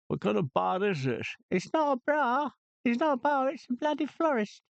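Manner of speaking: high, indignant comic voice; high mock outrage at the bar; outraged florist. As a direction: squeal at the customer in outrage. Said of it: "comic bar-owner rant".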